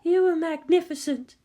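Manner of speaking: a caring nurse checks on her patient; gentle nurse. Acted. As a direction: nurse asking an injured girl about pain. Nurse praises the girl.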